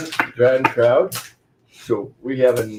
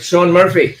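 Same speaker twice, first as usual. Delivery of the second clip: higher, louder call to a friend; 7 semitones higher, 8 dB louder than usual. Pretend. Drinker addressing an absent friend before a toast, calling out. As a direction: hail someone by name, raised voice.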